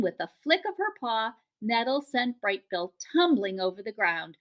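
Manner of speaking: ordinary delivery, nothing marked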